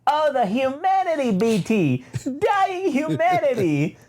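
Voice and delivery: high, gloating droid voice, drawn out; Triple Zero voice. Retold or imitated. Triple Zero gloats to B T over the burning enemy, high and slow. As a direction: savor the carnage like a delighted butler. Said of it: "Triple Zero says".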